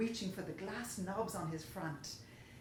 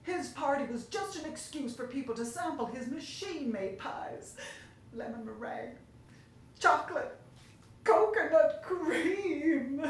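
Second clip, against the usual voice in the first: a baker sobbing over his pies, louder and louder; 5 semitones higher, 6 dB louder than usual. Acted. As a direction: break down by the end. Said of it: "He let out a sob".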